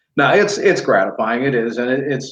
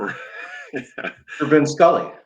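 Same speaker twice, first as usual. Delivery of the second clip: starstruck; 3 semitones higher, much slower than usual. Retold or imitated. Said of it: "I couldn't speak to him".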